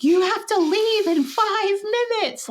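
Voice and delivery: put-on high, slow scolding of a child; impatient mother. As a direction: stretch it out high, hurrying a kid out the door. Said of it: "that doesn't feel very compassionate or loving".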